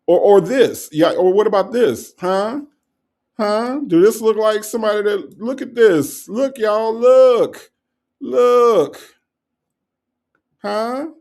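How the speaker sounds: loud, high, insistent demands; mock outrage. Host demanding viewers look at the evidence, mock outraged. Shows more evidence to the commenter.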